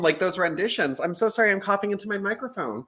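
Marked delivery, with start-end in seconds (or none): none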